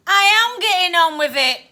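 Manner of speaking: loud, slowing Cockney reveal; booming, suspenseful self-introduction; dramatic announcement. Acted. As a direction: build up to a drawn-out final word. Ghost announces himself with great build-up.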